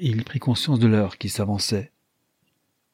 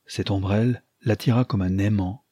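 ordinary delivery, nothing marked

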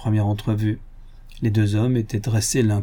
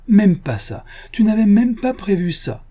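loud higher mocking cry; laughing scorn; 11 semitones higher, 4 dB louder than usual. A narrator's reading. Lupin guffaws at the locked door, loud mocking. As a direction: laugh in his face. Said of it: "Lupin s'esclaffa".

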